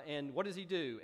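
normal talking voice

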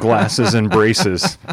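slow, loud, laughing. Real, not acted. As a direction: laugh through the punchline slowly. Laughing punchline among friends.

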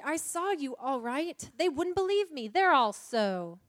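earnest protest, stretched and high; indignant Lucy. Acted. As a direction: complain emphatically as the aggrieved Lucy.

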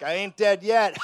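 put-on loud, high, laughing old man; cackling old grandpa. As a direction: shout it like a prankster grandpa. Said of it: "He's like".

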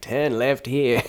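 put-on drawn-out, wobbly Australian accent; slipping Australian accent. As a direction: fake an Australian accent that comes and goes. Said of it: "my accent was, like, going in and out".

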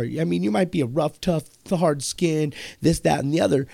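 normal talking voice

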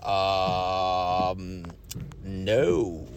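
low, hesitant mumble of a caught driver; mumbling to the soldier at the checkpoint, low and sheepish. Retold or imitated. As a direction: drop way down and mumble the no. His own hesitant answer at checkpoint.

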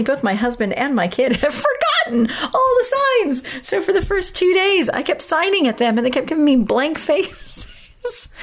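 high-pitched, laughing through the words; amused laughter. Real, not acted. Telling listeners how husband and kid forgot the signs, amused laughter. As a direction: let real laughter push the voice up.

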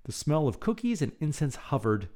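plain reading voice

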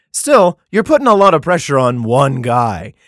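ordinary talk

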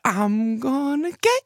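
put-on high, drawn-out sing-song child voice; creepy little kid. Mom imitating her daughter in her ear, creepy high child voice. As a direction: creep it out slowly like an eerie toddler. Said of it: "Your kid creeps me out".

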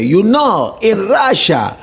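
imitating a Russian accent, pitched high; mock Russian comic patter, lightly hammed; Yakov Smirnoff. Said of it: "he would say".

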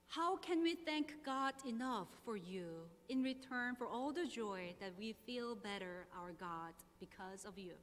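normal talking voice

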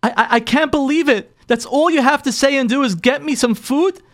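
high, incredulous retold wife; imitated exasperated disbelief; beside herself. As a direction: act out an upset wife, pitch soaring with indignation. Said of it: "she's, like, beside herself".

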